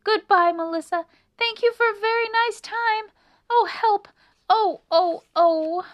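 high, loud squeaks; frantic mouse squeals; panicked farewell. A narrator's reading. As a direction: call goodbye while fleeing in fright. Annie flees shouting farewell.

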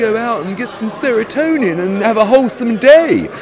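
high, silly whiny mimicry; hammed-up high mocking voice; mocking youngster impression. Mock impression of the younger guest.

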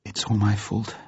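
soft self-blame near tears; hushed tearful confession; muffled, tearful. A narrator's reading. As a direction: say it small and very quietly. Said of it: "she said in a muffled voice".

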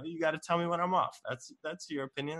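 ordinary talk

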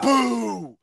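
one long retold boo; imitated heckler's boo. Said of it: "That's what he says to me".

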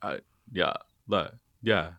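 lightly imitated monotone guy; low straight guy voice. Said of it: "low straight guy voice that's sort of monotone".

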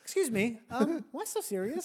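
sweetly ask, like a prankster; mock polite question. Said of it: "I would always ask him".

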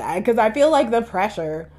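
ordinary delivery

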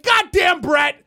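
high, shouted demand; cartoonish boss outburst; mock angry boss. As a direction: rant at an underling, hammed up.

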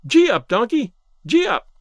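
put-on loud, high gleeful child shouting; delighted child's shout. A narrator's reading. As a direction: yell it like a thrilled little boy. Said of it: "shouting with delight in his merry child's voice".

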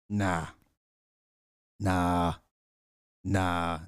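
low, drawn-out refusal; deep nah. Real, not acted. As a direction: drop the voice way down.

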